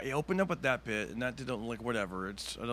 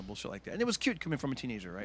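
ordinary delivery

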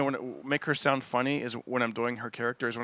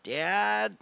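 rising, higher calls; son calling dad; 7 semitones higher, much slower than usual. Retold or imitated. Comic imitates his son calling him again and again. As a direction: call dad higher each time. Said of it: "the way he does it".

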